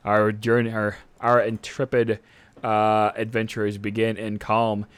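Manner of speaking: normal talking voice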